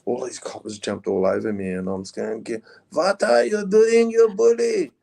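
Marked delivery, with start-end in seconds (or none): 2.4-4.8 high, slow put-on Swedish tourist voice protesting; fake Swedish accent. Arrested robber posing as Swedish tourist to cops, put-on Swedish accent. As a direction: play a Swedish backpacker outraged at the cops. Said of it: "you put on the voice".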